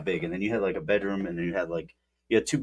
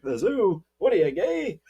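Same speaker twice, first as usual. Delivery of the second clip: higher, incredulous mock scoff; mock grandpa scoff; 10 semitones higher, slower than usual. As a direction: toss it off like a cranky old grandpa. Grandpa punchline in retold joke.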